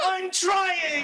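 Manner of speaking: very high shouted squeal; fail to rein in the hysteria; shrieking comic desperation; panicked. Acted. Trying to stop panicked squealing.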